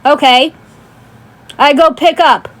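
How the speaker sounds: normal talking voice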